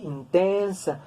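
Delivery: "intensa" with the middle syllable TEN drawn out; teacher showing where "intensa" is stressed, in-TEN-sa. Shown as a demonstration. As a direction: lean on the second syllable of "intensa", then fall away. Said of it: "tiene el acento aquí".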